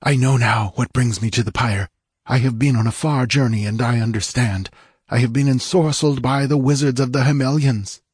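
urgent dying plea. Acted. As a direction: hurry her before his soul is taken. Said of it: "his weakening voice was rational".

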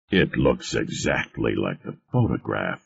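low, soft admiration; quietly impressed, pitch dropped; admiring. A narrator's reading. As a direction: admire it under your voice. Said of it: "Varick said admiringly".

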